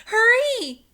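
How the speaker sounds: high loud call back over the shoulder; girl shouting back to her brother to hurry. A narrator's reading. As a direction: yell back at him to hurry. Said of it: "Annie called back to him".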